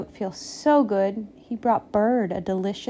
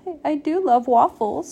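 high, halting, tearful; mildly weepy, pitched-up voice; sniffing; 6 semitones higher than usual. A narrator's reading. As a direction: stammer it out after a cry. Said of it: "sniffed Pig".

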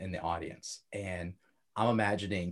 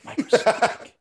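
high teasing; 13 semitones higher, 8 dB louder than usual. Pretend.

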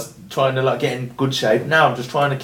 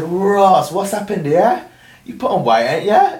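high, teasing voice of a friend; 6 semitones higher, 3 dB louder, slower than usual. Retold or imitated. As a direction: tease him about his belly, lightly. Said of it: "She said".

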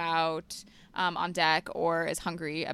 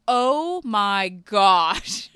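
loud shocked oh my gosh; shocked gasp; 7 dB louder, much slower than usual. Retold or imitated. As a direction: react to a fresh horror. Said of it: "I go".

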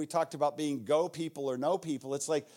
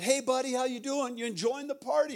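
breezy higher-pitched hello to a stranger; friendly greeting; 5 semitones higher, faster than usual. Retold or imitated. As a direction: greet him like a buddy, pitched up.

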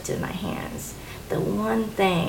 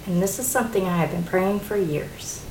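ordinary delivery

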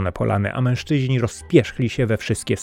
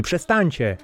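higher, pleading cry; 7 semitones higher than usual. A narrator's reading. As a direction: beg them to stop, voice lifted. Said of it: "prosiłem".

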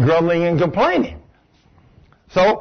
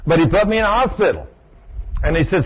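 ordinary talk

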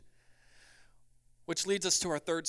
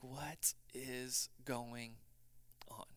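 bewildered whisper; 4 semitones lower, 16 dB quieter, much slower than usual. Acted. Preacher voicing confused believer.

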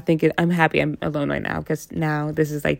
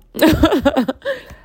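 redone laugh; 10 semitones higher than usual. Real, not acted. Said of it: "That's better".

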